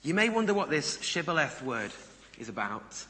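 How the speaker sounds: normal talking voice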